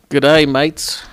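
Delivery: mock English-Australian accent; put-on English accent drifting Australian. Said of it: "the English accent that long".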